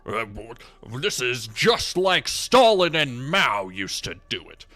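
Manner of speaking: loud, indignant radio-pundit rant, drawn out; blustering mock radio tirade; Rush Limbaugh. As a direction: rant like an outraged talk-radio host, hammering the words. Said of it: "fucking Rush Limbaugh's cancerous".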